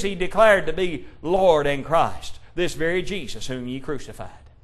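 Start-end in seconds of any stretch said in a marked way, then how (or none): none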